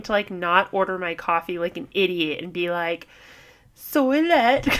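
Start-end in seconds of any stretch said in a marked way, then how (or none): 3.8-4.7 higher-pitched mock London accent; badly faked London accent; mimicked London accent. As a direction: order like a fake Londoner. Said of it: "like, mimicking them".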